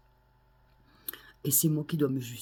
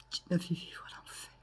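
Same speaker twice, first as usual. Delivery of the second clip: whispered accusation; 3 semitones higher, 7 dB quieter than usual. Real, not acted. Accusing mother, voice drops.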